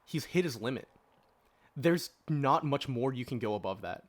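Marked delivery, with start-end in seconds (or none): none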